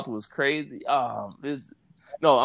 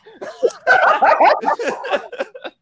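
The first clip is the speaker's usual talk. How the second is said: loud high laugh, real and helpless; high burst of laughter; 9 dB louder than usual. Real, not acted. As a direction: crack up at the memory. Laughing at his own story.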